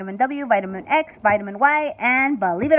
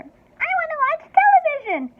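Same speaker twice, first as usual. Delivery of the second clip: much higher, shrill squeal; 3 dB louder than usual. A narrator's reading. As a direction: screech like a furious little boy. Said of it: "he squealed".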